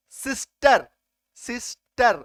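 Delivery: सिस्टर ending in a short "uh-r"; uh sound. Shown as a demonstration. Teacher showing the rule on सिस्टर, uh sound. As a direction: say सिस्टर with अ in the last syllable. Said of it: "अ होगा".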